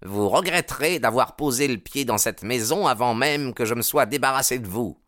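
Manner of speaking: haughty promise of revenge; threatening rival. A narrator's reading. Baldwin refusing to dirty his hands, threatening.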